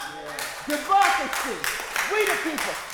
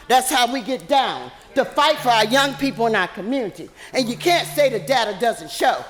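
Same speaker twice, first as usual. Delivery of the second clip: ordinary talk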